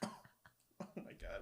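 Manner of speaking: slow breathless whisper; stunned whisper. Real, not acted. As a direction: whisper it, overwhelmed.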